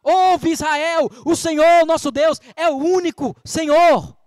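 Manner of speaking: slow, weighty recitation of the creed; solemn proclamation. Shown as a demonstration. Preacher proclaiming the creed to the congregation, slow and solemn. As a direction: declare each word slowly and firmly. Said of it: "A ordem é".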